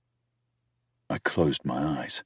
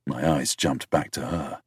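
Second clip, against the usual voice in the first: ordinary delivery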